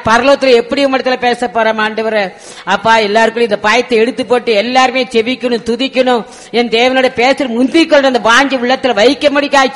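loud pleading prayer, pressing on and on; fervent prayer. Acted. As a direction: cry out to God with urgency, then settle down. Fervent intercessory prayer leading.

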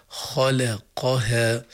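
خلقها drawn out very slowly, letter by letter; slow careful reading. Shown as a demonstration. Teacher showing an unhurried reading of a hard word. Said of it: "لو تأنى شوي الواحد".

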